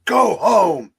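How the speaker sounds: firm command. Retold or imitated. Henry Winkler ordering the dog away, loud command. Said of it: "curls like this".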